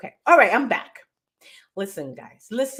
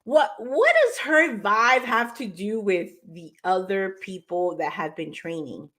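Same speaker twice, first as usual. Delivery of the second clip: normal talking voice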